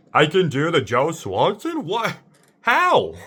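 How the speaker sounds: incredulous questions climbing high; mock astonishment. Reacting to discovering the voice by accident, in mock astonishment. Acting out amazement at own impression.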